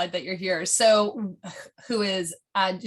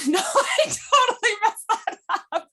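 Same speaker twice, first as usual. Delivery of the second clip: laughing; 13 semitones higher, 4 dB louder than usual. Real, not acted. Laughs at own mispronunciation.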